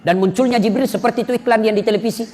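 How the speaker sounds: ordinary talk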